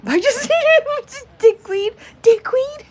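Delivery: high, loud laughing squeal; cracking up while saying dickweed. Real, not acted. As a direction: squeal the word through laughter. Laughing at own insult.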